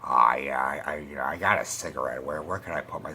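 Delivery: low, rambling, strung-out mumble. Acted. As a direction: impersonate a wasted musician, deep and halting. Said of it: "he's got the exact same fucking voice".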